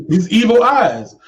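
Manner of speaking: high, laughing repeat. Real, not acted. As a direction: say it through a laugh. Repeats joke through laughter.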